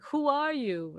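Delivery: high, drawn-out tipsy question; imitated drunk man. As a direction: lightly mimic a nosy drunk. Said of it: "He's so drunk".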